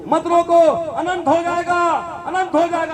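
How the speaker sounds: mildly acted, high-pitched insistence; urgent plea. Daughter urgently begs not be stopped. (ऊँचे सुर में बेटी का आग्रह)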